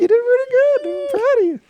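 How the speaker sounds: very high, squeaky falsetto praise; high-pitched. Pretend. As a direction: coo praise in a silly falsetto. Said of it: "Where's your high pitch question?".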